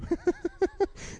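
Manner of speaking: laughing at his own joke; a quick airy chuckle; high breathy laugh. Real, not acted. Preacher laughing at his joke to the congregation, airy laugh.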